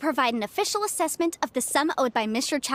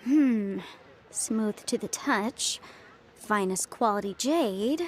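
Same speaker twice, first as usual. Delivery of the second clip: pondering; 3 semitones lower, slower than usual. Acted. Detective musing over a clue.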